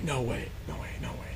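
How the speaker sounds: whispering no way over and over; whispered disbelief. Retold or imitated. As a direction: breathe it out in spooked disbelief. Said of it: "he's like".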